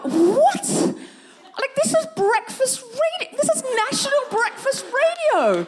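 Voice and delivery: shrieky, very high disbelief; high-pitched outrage. Retold or imitated. Comedian re-enacting her shock on breakfast radio, high-pitched indignation. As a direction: climb to a squeaky pitch, appalled. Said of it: "I got a bit high-pitched".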